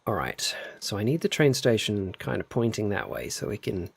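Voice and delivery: unvoiced whisper while planning the track; hushed thinking aloud while building; whispering to herself. Real, not acted. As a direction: whisper the plan under the breath.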